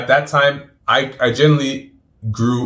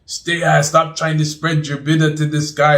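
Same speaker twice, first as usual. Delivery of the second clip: higher-pitched mock yell; retold yelling; 6 semitones higher than usual. Man yelling at the guy over bidah, retold yelling. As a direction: imitate someone scolding, pitched up. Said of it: "yelling at the guy saying".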